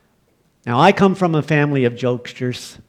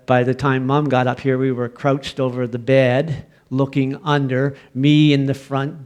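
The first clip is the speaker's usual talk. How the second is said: ordinary talk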